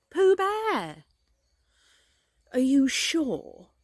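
high, loud, drawn-out surprise; astonished. A narrator's reading. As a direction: question it in rising disbelief. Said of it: "still more surprised".

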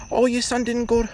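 high prissy mock voice; high mock gossip. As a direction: mimic the judging family friends. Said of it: "Tommy and fucking Sally".

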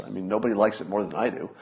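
ordinary talk, nothing marked

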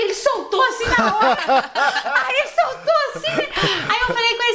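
real laughter bubbling over the retold line; laughing. Real, not acted. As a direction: laugh it out while telling the story. Laughing while retelling.

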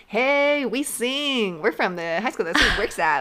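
loud, high mock show-choir announcement; crazy showy hype. Host mocking glee kids who go crazy, hammed-up hype. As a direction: ham it up like attention-hungry performers. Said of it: "trying to go crazy".

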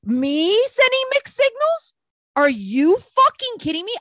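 high, incredulous outrage; indignant. Retold or imitated. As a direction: retell it affronted, voice shooting up. Acting out outrage at his accusation.

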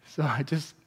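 normal talking voice

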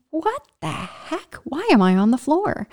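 puzzled at finding herself on the floor; bewildered. Retold or imitated. From a heap on the floor, asking herself, bewildered. Confused on the floor after falling.

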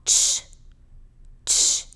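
"Ch" as a voiceless burst, no vowel; final ch alone. Shown as a demonstration. Teacher isolating the end of letter H, bare ch. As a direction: isolate "Ch", just the hushing release. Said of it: "Presta atenção no finalzinho".

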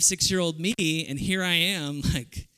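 normal talking voice

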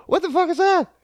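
high-pitched startled mock exclamation; half-acted shock at a noise in the night. Woken by machine noise.

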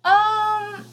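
reluctant yes in a high little voice; hesitant high squeak. Retold or imitated. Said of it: "And I was like".

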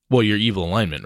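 normal talking voice

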